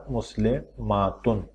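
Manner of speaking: مسلماتن said slowly, stretching the long aa before the ending tun; teacher stretching the new ending; drawn-out demo word. Shown as a demonstration. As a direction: spell out مسلماتن slowly so the added aa is heard. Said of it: "آخر میں آواز کیا آئے گی؟".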